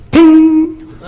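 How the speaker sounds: high, loud ringing "ping"; imitated ping. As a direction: sing out a piercing little ping. Imitates the sound in joke.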